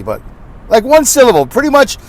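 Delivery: normal talking voice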